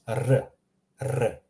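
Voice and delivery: R as a short tongue-tip trill; rolled R. Shown as a demonstration. Teacher showing students the Croatian R, rolled R. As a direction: trill the R briefly on its own. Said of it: "this R is a rolling R".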